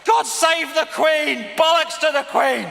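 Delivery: mocking the anthem with a loud rude shout. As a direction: bellow it like a crowd, then turn it on her. Mock anthem cry then retort.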